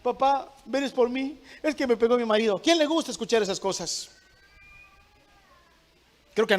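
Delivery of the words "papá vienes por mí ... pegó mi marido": lightly voiced distressed daughter, higher pitch; filha pedindo socorro. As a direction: imitate a married daughter calling dad for rescue. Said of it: "Quién le gusta que le llame su papá".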